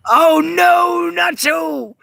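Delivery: sudden high shout of the dog's name; alarmed call. Real, not acted. Owner catching the dog Nacho misbehaving, alarmed high shout.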